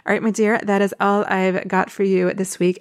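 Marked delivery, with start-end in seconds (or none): none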